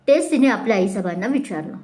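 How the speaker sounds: plain reading voice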